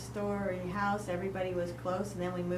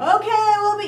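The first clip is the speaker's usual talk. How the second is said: loud, high shout; yelling back; 12 semitones higher, 14 dB louder than usual. Retold or imitated.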